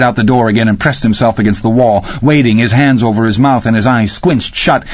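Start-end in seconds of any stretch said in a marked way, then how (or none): none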